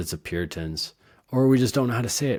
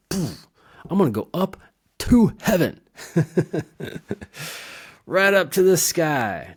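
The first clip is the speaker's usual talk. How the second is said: high, loud, floaty voice drifting up; airy mock flight; 9 semitones higher, 6 dB louder than usual. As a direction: float off toward heaven, playful. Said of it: "I'm talking about going".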